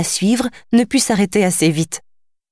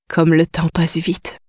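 wistful musing; slower than usual. A narrator's reading. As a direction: let it drift, slow and a touch sad. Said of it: "songea Tara".

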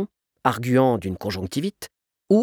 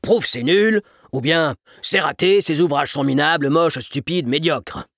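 emphatic, higher-pitched teaching father; earnestly didactic, animated list; lecturing; 4 semitones higher, 4 dB louder than usual. A narrator's reading. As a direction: spell out each insult as a lesson in manners. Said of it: "sermonna le père".

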